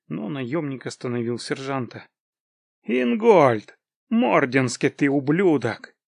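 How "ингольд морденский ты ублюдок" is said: angry recognition. Acted. Mercenary recognises an old enemy, angry exclamation. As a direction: call out the name, then curse him loudly.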